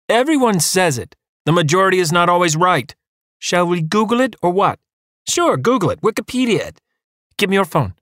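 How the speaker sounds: high, squeaky back-and-forth; childish bickering. Acted. Husband and wife squabbling, high and childish. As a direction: squabble like kids.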